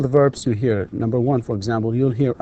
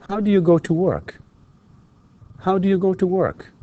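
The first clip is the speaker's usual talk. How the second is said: high questions dropping steeply on "live", "do", "work"; falling intonation; 7 semitones higher than usual. Shown as a demonstration. Teacher drills information questions for repetition, falling intonation. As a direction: hold it high, then let the last word fall. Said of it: "either rising or falling intonation".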